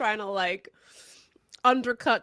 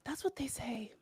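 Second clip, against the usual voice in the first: whispered aside; 12 dB quieter than usual. Real, not acted. Teasing aside about TikTok use.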